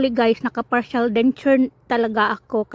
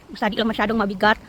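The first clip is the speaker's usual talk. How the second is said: ordinary delivery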